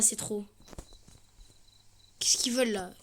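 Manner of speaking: ordinary talk, nothing marked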